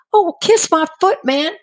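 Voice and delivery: high-pitched huffy comeback; hammed-up indignant retort; mock indignation. Her indignant thought at being rejected.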